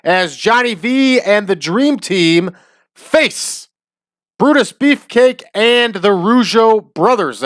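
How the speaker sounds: high, drawn-out ring announcer call; hammed-up wrestling announcer bellow. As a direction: boom it out like an arena announcer. Announcing the main event lineup.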